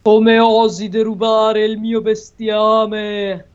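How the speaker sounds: slow, booming monster voice; monster roar. Acted. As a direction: bellow it like an angry giant. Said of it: "urlò il mostro".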